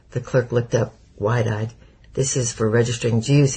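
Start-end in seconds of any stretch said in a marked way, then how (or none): none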